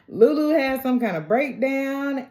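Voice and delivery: usual speaking voice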